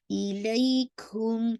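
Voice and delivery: इलैकुम with ऐ held for about two counts; leen vowel. Shown as a demonstration. Teacher shows the leen stretch, slow chant. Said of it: "almost टू count पढ़ना है".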